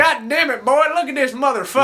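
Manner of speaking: loud, high country yokel. Acted. Redneck voiced finding genie.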